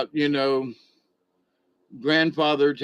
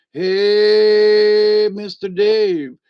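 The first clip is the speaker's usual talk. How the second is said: loud, high hail across the camp, slow on the name; calling out; 7 semitones higher, 9 dB louder, much slower than usual. Retold or imitated. Indian folk at the camp call out to Dave, loud hail. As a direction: shout a cheerful greeting to someone across the field.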